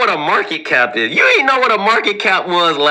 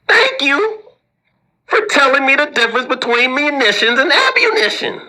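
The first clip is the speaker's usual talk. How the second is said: sarcastic thanks; 7 semitones higher than usual. Acted. Mock thanks to a commenter.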